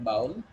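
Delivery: "bowl" with an OW vowel, like howl; common mispronounced bowl. Shown as a demonstration. Teacher quoting many people's mistake, bowl as baul. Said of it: "many people call it bowl".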